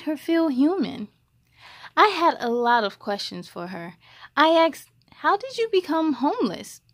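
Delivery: clear, even read-aloud voice; story narration. A narrator's reading. Host reading a picture book to young viewers, storytelling voice.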